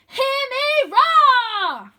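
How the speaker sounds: high, loud, slow triumphant cry with a laugh. A narrator's reading. Arthur crying out after surviving the archway, triumphant. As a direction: roar it out in victory. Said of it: "I cry".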